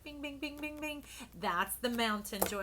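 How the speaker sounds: high sing-song bing bing; ping it out like a bell; playful sound effect. Retold or imitated. Reader voicing the card guy, high playful bings. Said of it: "And he's like".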